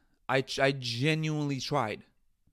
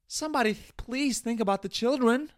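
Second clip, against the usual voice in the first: much higher, hammed-up pleading; a hammed-up, pitched-up politician's plea; mock plea; 9 semitones higher than usual. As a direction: parody a politician begging for the children.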